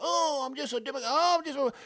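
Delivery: high mock whiny voter.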